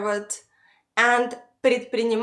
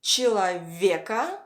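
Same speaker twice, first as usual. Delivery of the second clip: человека with a long, stressed "ве"; 3 semitones lower than usual. Shown as a demonstration. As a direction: lean on the "ве" in человека. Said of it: "the stress falls on".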